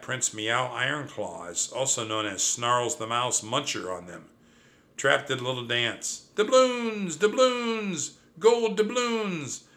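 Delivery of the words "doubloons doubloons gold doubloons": very high, drawn-out delighted cry; high, stretched, jubilant exclamation; gleeful crowing. A narrator's reading. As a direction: relish the word in a high singing-out voice. Trap dances, delighted by the word.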